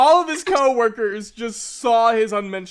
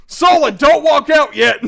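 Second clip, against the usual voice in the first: loud, high acted warning yell; urgent shout; 5 semitones higher, 10 dB louder than usual. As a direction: holler a frantic stop across the room.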